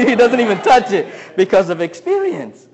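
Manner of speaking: normal talking voice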